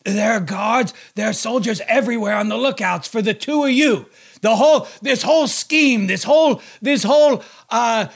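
overacted death scene. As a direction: melodramatic last warning. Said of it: "I'm gonna be Harry Dean".

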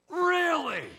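high, loud, dripping with sarcasm; a sarcastic jab that cuts off the rant; sarcastic really. Shown as a demonstration. Pastor interrupting a wronged person, sarcastic. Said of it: "with all the sarcasm that I can muster".

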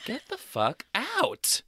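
mock disbelief. Mock disbelief, echoing co-host.

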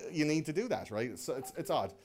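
ordinary delivery

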